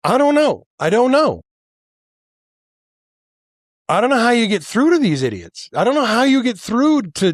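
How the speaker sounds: worked-up rant, fast and high; exasperated. Real, not acted. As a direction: throw up your hands in frustration. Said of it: "I'm worked up".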